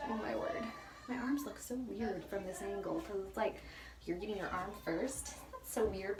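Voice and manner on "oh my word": ordinary talk, nothing marked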